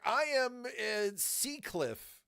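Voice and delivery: slow, drawn-out admission; slow guilty answer to a friend; sheepish slow reply. Retold or imitated. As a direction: drag it out sheepishly. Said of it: "And I'm like".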